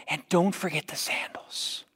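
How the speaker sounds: soft aside in a whisper; whispered afterthought. Acted. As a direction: whisper it like a tender extra.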